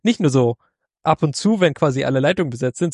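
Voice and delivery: ordinary delivery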